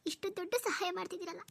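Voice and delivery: high-pitched tearful thanks in broken phrases; crying with gratitude. Acted. Sister overwhelmed by a friend's big help, crying. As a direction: sob your thanks, pausing to gather yourself. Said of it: "ನೀನ್ ಸುಮ್ನಿರು, ಅಳ್ಬೇಡ".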